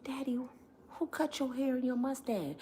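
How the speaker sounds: hushed whisper in church. Retold or imitated. Daughter whispering to dad in church pew. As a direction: whisper it so nobody hears.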